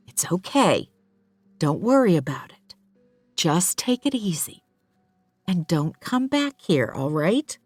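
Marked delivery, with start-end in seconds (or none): none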